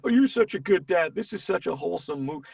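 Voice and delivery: hammed-up admiring comment; gushing commenter. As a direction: gush like an online fan. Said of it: "when people comment".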